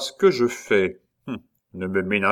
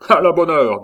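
éclat; 7 semitones higher, 9 dB louder than usual. Acted. Le comte accepte le duel.

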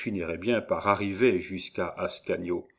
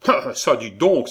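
louder, offended exclamation; indignant cry; 4 semitones higher, 8 dB louder than usual. A narrator's reading. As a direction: protest as if wrongly accused. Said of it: "s'écria l'écolier, dont la conscience se révoltait". (exclamation indignée)

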